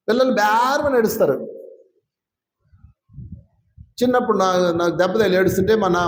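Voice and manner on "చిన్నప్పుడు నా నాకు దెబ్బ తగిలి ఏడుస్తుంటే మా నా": ordinary delivery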